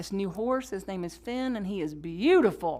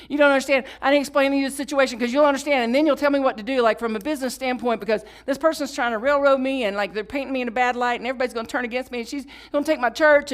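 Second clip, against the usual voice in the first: loud, higher, rushing worried rant; frantic pleading; 4 semitones higher, 6 dB louder, faster than usual. Retold or imitated. Pastor panicking on the phone to her mentor, loud and rushed. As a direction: pour it out in a panic.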